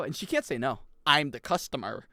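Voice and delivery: normal talking voice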